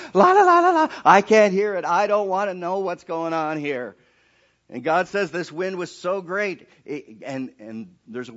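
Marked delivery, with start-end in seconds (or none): 0.1-1.9 high sing-song la la, fingers in ears; childish refusal to listen; mock la-la-la. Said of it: "fingers in his ears". 1.9-4.0 loud, high preacher's build; fiery sermon delivery; full-force preaching. Acted.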